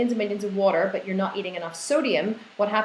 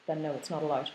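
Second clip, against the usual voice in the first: deadpan no; 5 semitones lower, 8 dB quieter than usual. Retold or imitated. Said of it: "I'm like".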